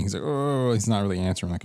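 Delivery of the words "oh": higher-pitched mock moan from a sick man; imitated moan. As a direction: moan out a drawn "oh" like a patient. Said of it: "he just starts moaning".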